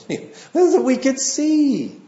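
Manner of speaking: high, drawn-out mock plea; blind men pleading. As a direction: play their answer high and stretched. Acts out blind men's obvious answer.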